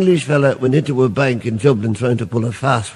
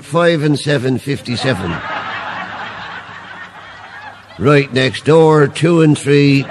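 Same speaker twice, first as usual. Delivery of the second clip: bingo caller; 3 semitones higher than usual. Acted. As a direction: call the numbers to the whole hall. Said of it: "the priest had called the numbers".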